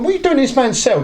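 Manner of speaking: high, quick mock questioning; urgent retold demand; retold challenge. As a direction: imitate confronting someone, pitch way up. Retold challenge to the two kids.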